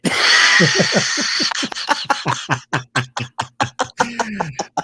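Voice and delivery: long, loud, high laugh; big laugh. Real, not acted. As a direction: crack up loudly and keep going. Laughing after the slapping gag.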